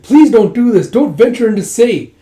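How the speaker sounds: high, loud mock desperation; acted panicky plea; frantic pleading. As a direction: implore her loudly not to go. Acted plea against venturing out.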